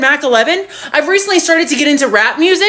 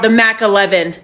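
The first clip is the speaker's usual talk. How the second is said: low, assured statement of MAC ELEVEN; confident answer; 6 semitones lower than usual. Retold or imitated. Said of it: "I so confidently was like".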